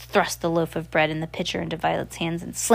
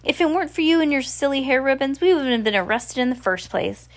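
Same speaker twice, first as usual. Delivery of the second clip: snapping at brother; 7 semitones higher than usual. Acted. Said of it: "Violet snapped".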